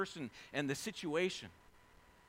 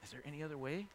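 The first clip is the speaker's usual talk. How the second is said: soft, hushed prayer to the Father; hushed pleading prayer; quiet plea; 14 dB quieter, faster than usual. Retold or imitated. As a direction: plead gently, almost under the breath. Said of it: "He says".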